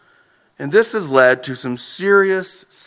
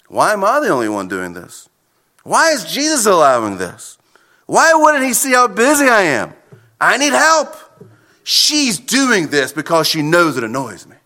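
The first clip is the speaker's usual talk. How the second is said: self-pitying. Acted. Martha fuming over serving alone, self-pitying. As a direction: build the grievance toward a plea for help. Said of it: "It goes from the self-pity".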